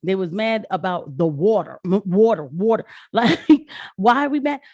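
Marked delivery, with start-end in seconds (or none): none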